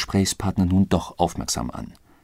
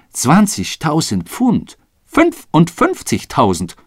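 emphatic amount; 7 semitones higher, 6 dB louder, slower than usual. Acted. Stunned about huge sum.

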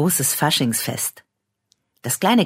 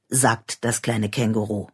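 plain reading voice

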